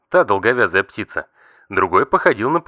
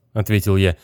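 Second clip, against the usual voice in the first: ordinary talk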